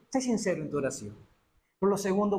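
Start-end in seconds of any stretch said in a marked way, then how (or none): none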